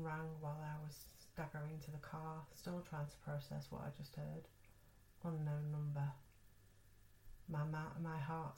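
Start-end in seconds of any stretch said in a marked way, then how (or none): none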